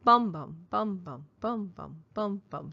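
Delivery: each Bum stressed, each following bum light; trochaic beat. Shown as a demonstration. Teacher chanting trochee rhythm for students, stress first beat. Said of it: "a trochee is the reverse of that".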